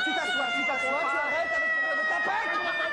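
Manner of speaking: high shouted telling-off; scolding shout. Acted. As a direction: order him down hard. Teacher scolds Jean-Marie.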